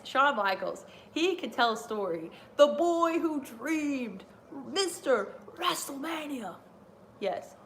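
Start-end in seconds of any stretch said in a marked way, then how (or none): 2.6-6.5 slow, higher ring announcer delivery. Acted. Fan introducing Shawn Michaels, a slow announcer call. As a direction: proclaim it like an announcer. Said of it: "announcing Shawn Michaels' nicknames".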